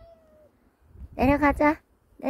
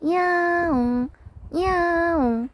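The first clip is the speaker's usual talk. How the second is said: cat meow; 6 semitones higher, 3 dB louder than usual. Pretend. Meowing back at the cat.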